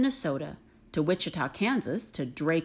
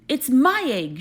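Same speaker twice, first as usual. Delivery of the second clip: louder, indignant burst; sputtered; 5 semitones higher, 6 dB louder than usual. Acted. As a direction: spit it out in outrage. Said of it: "she sputtered".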